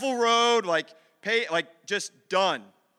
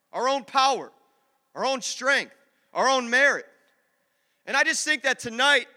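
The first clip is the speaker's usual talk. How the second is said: higher, louder sermon delivery with strong beats; forceful preaching; 5 semitones higher, 6 dB louder than usual. Real, not acted. Preacher tells the room what God says tonight, forceful preaching. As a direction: drive each phrase home to the congregation.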